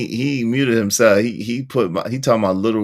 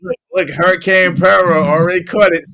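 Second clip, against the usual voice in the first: ordinary talk, nothing marked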